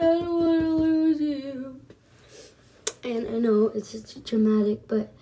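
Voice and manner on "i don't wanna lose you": high, hammed-up tearful wail; mock sobby plea, held high; retold crying. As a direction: imitate clinging to a friend in tears. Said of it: "so we're all crying".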